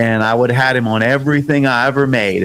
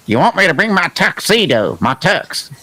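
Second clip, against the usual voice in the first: higher, lightly mimicked thick Southern accent; imitating a thick accent; 5 semitones higher than usual. Host mimicking Curtis finally asking about the tuxedo, light thick accent. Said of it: "He had a really kind of a thick accent for me".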